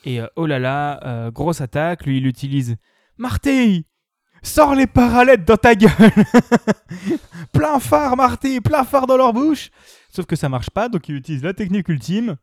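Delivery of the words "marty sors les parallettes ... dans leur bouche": high, loud shouted commands; shouting orders. Retold or imitated. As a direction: yell it at the dog, full volume. Character yelling at dog in film.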